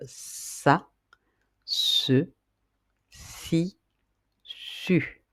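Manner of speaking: sa, se, si, su with a hissed S, si and su nearly whispered; syllables sa se si su. Shown as a demonstration. Teacher models sa se si su for pupils to repeat. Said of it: "on peut former les syllabes".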